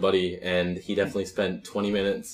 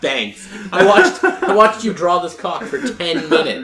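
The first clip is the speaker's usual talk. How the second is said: sarcastic; 9 semitones higher, 9 dB louder, slower than usual. Retold or imitated. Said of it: "I was like".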